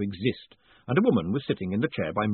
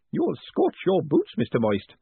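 teasing; 6 semitones higher than usual. A narrator's reading. Spools teases Moist.

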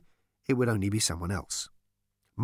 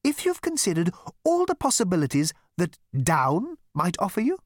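high, polite elevator voice; sweetly reasonable; 9 semitones higher, 3 dB louder than usual. A narrator's reading. Elevator pleading with Zaphod, sweetly reasonable. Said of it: "in its sweetest, most reasonable voice".

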